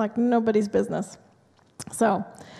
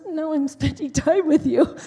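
laughing through the husband's line; higher, amused retelling; chuckling as she quotes him; 6 semitones higher, 3 dB louder than usual. Retold or imitated. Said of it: "He goes".

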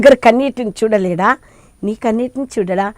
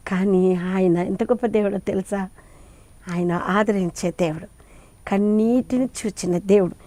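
softer, hushed reassurance; tender comfort; 4 semitones lower, 9 dB quieter than usual. Acted. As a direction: lower the voice to soothe the grieving. Comforting listeners about God. (మృదువుగా ఓదార్పుగా)

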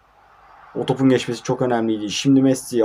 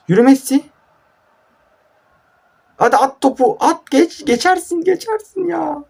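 excited urging. Real, not acted. Gamer yelling at Messi on screen to run, high excited urging. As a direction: egg the player on at the top of your voice.

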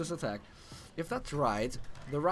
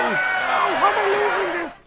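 loud, high disbelieving yell; frustrated shout; 9 dB louder than usual. Real, not acted. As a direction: yell in outrage at losing. Losing the round, yelling.

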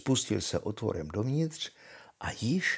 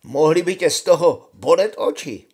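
loud, raised soldier's exclamation; calling out; 6 semitones higher, 10 dB louder than usual. A narrator's reading. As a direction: call it out boldly, higher and louder. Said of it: "zvolal voják". (hlasité zvolání vojáka)

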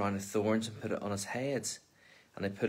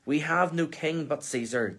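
higher, a touch louder retort; shouted back; 5 semitones higher, 3 dB louder than usual. Acted. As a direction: throw it back at Pilate, pitched up. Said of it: "the leading priest shouted back".

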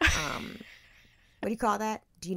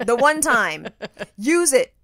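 pep talk; 3 semitones higher, 8 dB louder than usual. Retold or imitated.

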